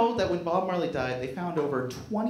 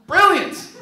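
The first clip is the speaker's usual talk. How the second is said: a loud, much higher mock "Brilliant!"; sarcastic shout; 12 semitones higher, 12 dB louder than usual. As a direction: exclaim it with heavy fake admiration. Sarcastic praise of a silly idea.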